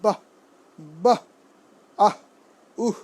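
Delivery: "বাহ", "আহ", "উহ" as short aspirated bursts; half-h ending. Shown as a demonstration. Teacher reviewing visarga, "বাহ", "আহ", "উহ" with half-h endings. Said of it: "অর্ধ হ হবে".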